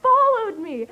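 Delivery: loud, high, drawn-out announcement; re-enacted fan excitement. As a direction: break huge news in a fangirl cry. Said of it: "I was so excited".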